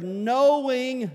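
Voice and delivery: knowing rung out high and loud; loud swell on knowing. Real, not acted. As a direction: lift and belt the word knowing. Preacher swells one scripture word.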